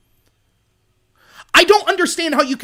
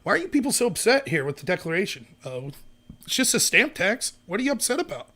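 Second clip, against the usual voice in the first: very soft, low, placid voice; hushed, sarcastically unbothered; mock mild objector; 6 semitones lower, 12 dB quieter than usual. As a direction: imitate someone meekly puzzled by the fuss. Imagined placid objector to founding fathers.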